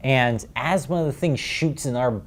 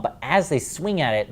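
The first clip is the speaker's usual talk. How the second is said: ordinary talk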